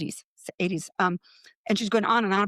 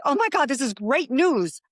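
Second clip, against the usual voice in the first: delighted; 6 semitones higher, 3 dB louder than usual. Retold or imitated. Cheerful reply to terminal diagnosis.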